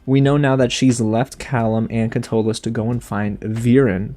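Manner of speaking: normal talking voice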